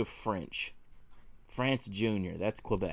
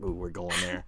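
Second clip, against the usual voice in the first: gleeful aside; 7 semitones lower, 7 dB quieter than usual. Retold or imitated. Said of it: "I was like".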